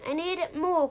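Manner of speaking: high-pitched insistent cry; mock-pleading, high but not shouted; mild storybook cry. A narrator's reading. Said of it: "cried Lou".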